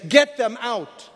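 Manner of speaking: slow, forceful imitated order; acted urgent command. Angels telling Lot to save family, urgent acted order. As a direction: drive home each word slowly.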